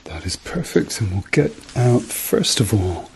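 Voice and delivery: normal talking voice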